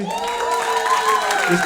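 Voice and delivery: high, slow triumphant shout; drawn-out shout. Real, not acted. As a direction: stretch it out like a cheer. Stretched celebratory shout.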